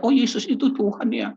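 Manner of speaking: newly believing person rejoicing over forgiven sins, high and loud; joyful new believer. Retold or imitated. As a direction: burst out in happy discovery.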